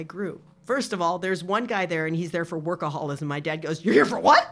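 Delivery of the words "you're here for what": retold disbelief rising on "what"; mock incredulity. Daughter imitating dad meeting a workaholic, incredulous. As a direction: question it as if absurd, laughter close.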